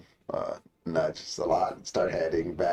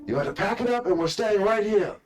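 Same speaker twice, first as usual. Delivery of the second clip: high, loud commanding bellow; orc general shouting; 14 semitones higher, 7 dB louder than usual. Acted. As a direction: bark the order to the troops. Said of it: "says the orc general".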